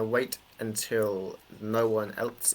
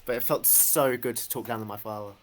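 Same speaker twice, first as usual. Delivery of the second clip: usual speaking voice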